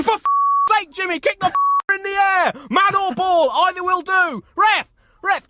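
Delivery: high, loud furious yelling; touchline rage. Retold or imitated. Parent raging at Jimmy and the ref, shouting. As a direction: bawl at the players and the ref. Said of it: "You're probably gonna hear them say".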